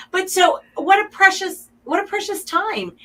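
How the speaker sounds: high, tender voice near tears; emotional. Real, not acted. Treasuring family quilting time, high and teary. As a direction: let the feeling break through, voice lifted high. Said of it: "I'm getting emotional".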